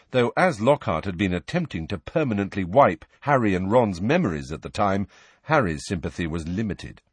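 ordinary delivery

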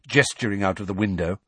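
usual speaking voice

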